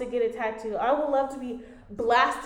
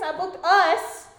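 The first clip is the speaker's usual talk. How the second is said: "us" stretched out slow in a raised voice; stress on US; 6 semitones higher, 5 dB louder, slower than usual. Retold or imitated. Ex confirming the surprise to girlfriend, "us" stressed and stretched. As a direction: quote him, dragging out "us". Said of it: "Emphasis on us".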